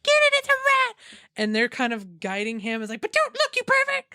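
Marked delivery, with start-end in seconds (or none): 0.0-1.0 very high squealed mock panic; hammed-up shrill alarm; panicking. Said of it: "They're all just panicking". 3.0-4.0 very high mock-scolding squeal; hammed-up high-pitched outrage; shrieking indignation. Panicking women scold the guard.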